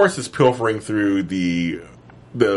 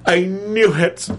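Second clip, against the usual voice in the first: excited; 8 semitones higher, 3 dB louder than usual. Real, not acted. Still excited.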